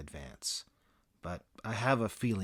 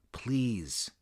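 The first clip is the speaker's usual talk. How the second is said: whispered please. Retold or imitated. Club manager privately begging the comic, whispered plea.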